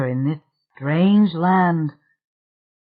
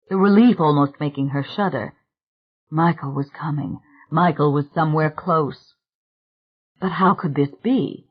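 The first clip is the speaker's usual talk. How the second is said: ordinary delivery, nothing marked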